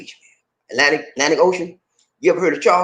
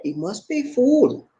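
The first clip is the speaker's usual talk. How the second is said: slow, high "He must be fool", drawn out; slowed-down translation; 8 semitones higher, slower than usual. Shown as a demonstration. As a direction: stretch "He must be fool" so it is understood. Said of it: "That's what we're trying to say".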